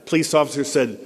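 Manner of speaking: ordinary talk, nothing marked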